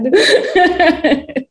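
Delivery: high-pitched hearty laugh; real laugh. Real, not acted. Panelist finishing her answer on imagination, laughing.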